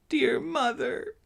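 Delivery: put-on a frightened child fighting back sobs; trembling, sob-choked. A narrator's reading. Daughter pleading with her mother, high tearful girl's voice. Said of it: "began to sob again".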